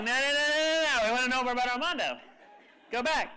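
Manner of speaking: high, loud, rapid pleading; excited begging. Retold or imitated. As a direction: beg her to go back, all at once. Group begging to hear more.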